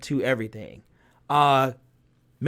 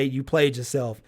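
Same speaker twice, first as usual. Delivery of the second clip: ordinary talk, nothing marked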